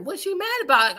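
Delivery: baffled. Retold or imitated. Retelling her own confusion.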